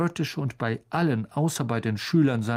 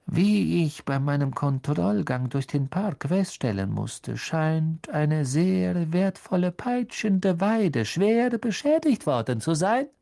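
sneering teacher; 5 semitones higher than usual. Acted. Snape lecturing with menace.

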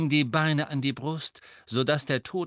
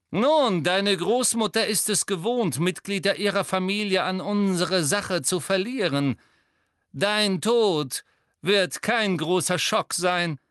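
higher, louder mocking villain, savouring his win; gleeful sneering Death Eater; 7 semitones higher, 5 dB louder than usual. A narrator's reading. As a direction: crow over the cornered boy. Malfoy threatening Neville. (höhnisch triumphierender Todesser, etwas höher und lauter)

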